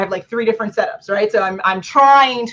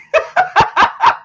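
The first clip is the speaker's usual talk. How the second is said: high laugh; 4 dB louder than usual. Real, not acted. High laugh at her own mock of filler words.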